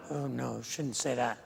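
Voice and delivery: hushed, scandalized tut-tut; a soft, lightly mocked gasp of disapproval; disapproving churchgoers. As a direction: quietly chide like a shocked church lady. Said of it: "And people go".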